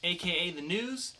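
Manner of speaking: loud, upbeat announcer sign-off; podcast host wrapping up the news; segment sign-off. Acted.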